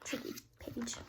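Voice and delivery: murmured aside; whisper. Real, not acted. Parent turning a page, whispering to the child. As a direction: breathe it under the story. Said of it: "aside while turning page".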